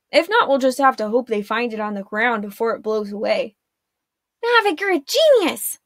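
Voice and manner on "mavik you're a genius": high, loud, delighted cry; excited exclamation. A narrator's reading. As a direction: burst out with joy at his idea. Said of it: "Arella exclaimed".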